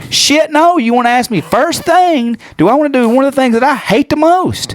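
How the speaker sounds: high-pitched indignant comic rant; exasperated. Real, not acted. Quarrel over being woken to swim.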